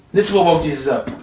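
ordinary talk

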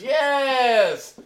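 long, high, loud cheer; acted jubilant outburst; exultant shout. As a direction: shout it out in drawn-out triumph. Spirit's acted cheering reply.

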